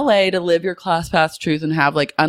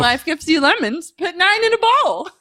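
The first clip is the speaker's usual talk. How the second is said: much higher silly joke voice; goofy high voice; 13 semitones higher than usual. Pretend. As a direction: ham up a nonsense proverb. [name] doing a silly voice.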